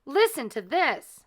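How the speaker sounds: eager high call; bursting with the news; excited. A narrator's reading. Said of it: "cried the stepmother".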